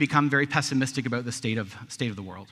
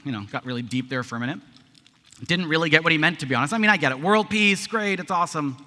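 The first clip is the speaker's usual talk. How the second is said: ordinary talk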